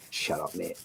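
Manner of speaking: low, quiet and dismissive; rude android voice. Retold or imitated. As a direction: sneer it like a rude robot. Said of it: "It's like".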